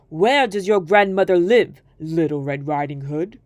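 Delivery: low, sly wolf voice greeting his prey; a wolf sizing up a child. Acted. Wolf questions girl.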